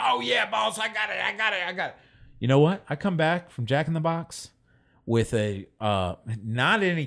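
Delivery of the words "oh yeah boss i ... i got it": high, shouty yes-boss promise; eager old lady. Retold or imitated. Old homeless lady calling him boss, high and shouty. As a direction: overplay the eager agreement.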